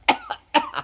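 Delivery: short, high coughs; high little fake cough; acted coughing. A narrator's reading. Paul coughing under the blanket, acted coughing. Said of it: "Paul hustete unter der Decke".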